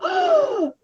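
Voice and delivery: a sudden high loud gasp of shock; sharp startled gasp. Shown as a demonstration. Coach acting out the body's jolt: startled gasp. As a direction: gasp as if caught off guard. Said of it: "your body's gonna go".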